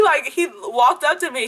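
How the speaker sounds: ordinary talk, nothing marked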